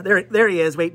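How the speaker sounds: ordinary talk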